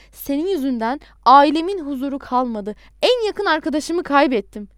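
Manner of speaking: loud, emphatic accusation; angry reproach. A narrator's reading. Adnan blaming the caller for his losses, angry. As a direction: hurl the blame, raising the voice. Acted quarrel, bitter reproach.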